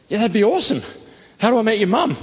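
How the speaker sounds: high and bright, keen; eager. Retold or imitated. Asking the girl how to meet her mum, high and eager. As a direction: jump at the chance.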